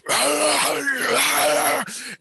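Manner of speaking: high, drawn-out mock screech; imitated screaming. Man imitating a creature screaming in his brain, high screech. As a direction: screech it like the thing in the dream. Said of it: "It's screaming in my brain".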